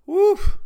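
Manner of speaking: high, loud gag-like woof; retching woof. Real, not acted. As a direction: blow out a nauseous woof. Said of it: "almost just threw up".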